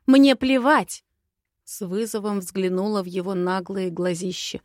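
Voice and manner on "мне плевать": high, loud defiant outburst; angry, raised-voice defiance. A narrator's reading. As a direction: throw it back as a challenge. Defies him in a quarrel.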